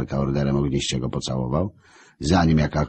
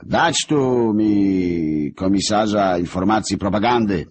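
slow, louder imperious order; commanding; 5 semitones higher, 4 dB louder, slower than usual. Acted. Chief of devils ordering his propaganda commissar, slow and imperious. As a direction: summon him like a tyrant. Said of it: "rozkazał wódz czartów".

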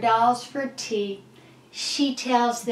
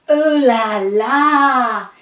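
loud, drawn-out delight; fancy, swooning exclamation; impressed exclamation; 7 dB louder, slower than usual. Acted. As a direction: savor it slowly and loudly. Excited arrival at fancy hotel.

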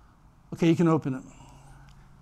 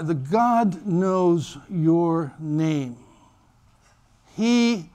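ordinary delivery

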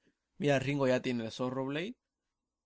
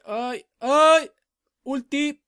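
much higher and louder cries; excited yell; 12 semitones higher, 14 dB louder, much slower than usual. Real, not acted. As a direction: shout out as the fight explodes. Fight burst, yelling.

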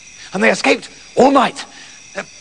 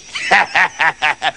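short, low villainous cackle; evil farmer's gloating laugh; wicked laugh; 5 semitones lower than usual. Acted. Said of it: "laughing wickedly".

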